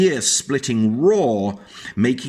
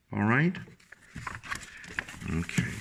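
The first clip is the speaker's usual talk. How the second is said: plain reading voice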